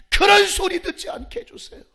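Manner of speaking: high, forceful prayer cry; loud plea. Real, not acted. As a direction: beg God at full voice. Prayer plea, then repeated hushed.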